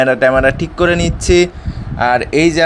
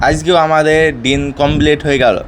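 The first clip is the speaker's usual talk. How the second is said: normal talking voice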